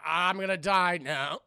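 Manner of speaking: slow, hammed-up last words; mock dying voice. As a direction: expire theatrically. Beast dying, acted.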